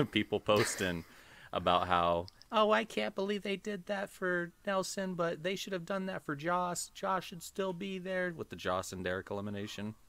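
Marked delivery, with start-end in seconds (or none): 2.5-8.3 high, whiny online-fan voice, mocking. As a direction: parody a fan complaining online, pitched way up. Mocking fans posting online.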